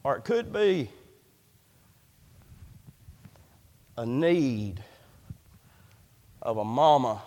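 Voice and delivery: soft sincere preacher's aside; tender. Real, not acted. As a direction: ease down for the struggling mama. Tender turn in sermon.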